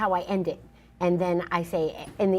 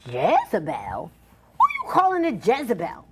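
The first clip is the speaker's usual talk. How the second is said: indignant comeback; 4 semitones higher, 4 dB louder than usual. Acted. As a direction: fire back at the insult, scandalized. Acted comeback to an insult.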